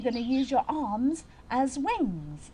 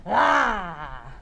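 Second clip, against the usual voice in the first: short, lower little roar; quick small-dinosaur roar, cut short; tiny dinosaur roar; 4 semitones lower, 6 dB louder than usual. Acted. Dinosaur doing one more tiny one, short roar. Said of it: "One more tiny one".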